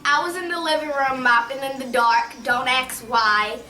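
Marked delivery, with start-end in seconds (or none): none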